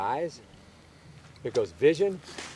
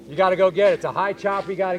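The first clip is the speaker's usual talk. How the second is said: urging shout; 3 semitones higher, 7 dB louder, faster than usual. Shown as a demonstration. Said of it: "coach urging player".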